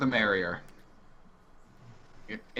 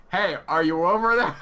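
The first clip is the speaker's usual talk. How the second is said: raised, higher call across the room; 5 semitones higher, 5 dB louder than usual. Acted. Player calling for the missing girl, raised searching call. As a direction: call out loudly to someone out of sight.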